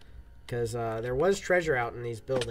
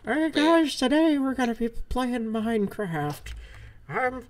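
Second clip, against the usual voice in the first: put-on old man voice; 8 semitones higher than usual. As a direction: play a forgetful grandpa opening a stream. Pretends to be eighty.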